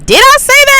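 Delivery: very high, doubting squeak; gaslit self-doubt. Retold or imitated. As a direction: ask yourself in stunned disbelief.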